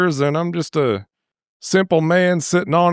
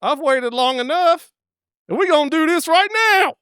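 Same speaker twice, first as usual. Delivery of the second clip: mock insistent man; 12 semitones higher than usual. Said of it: "he's like".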